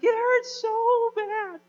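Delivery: high, loud, drawn-out wail; exaggerated slow, screechy cry; bawling child. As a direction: imitate a little girl bawling in pain. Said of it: "she's just bawling".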